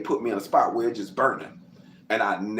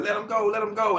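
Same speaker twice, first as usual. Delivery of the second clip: police yelling; 12 semitones higher than usual. Retold or imitated. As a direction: bark it like cops pulling him off. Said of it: "and they screaming".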